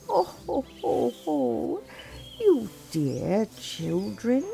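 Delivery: put-on high, slow, sugary old witch voice; friendly witch. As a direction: lure the children in slowly, like a sweet-talking witch. Old witch greets lost children.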